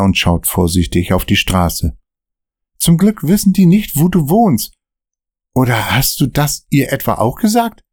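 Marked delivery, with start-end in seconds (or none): 2.8-7.8 higher, worried sidekick voice; nervy friend glancing at the mirror; nervous Dan. Acted. Dan warning Michael about the car behind, nervous and higher.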